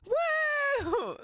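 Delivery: very high imitated woo; high mocking woo; mock whoop. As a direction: whoop like a celebrating driver. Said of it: "People were like".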